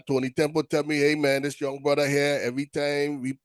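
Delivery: usual speaking voice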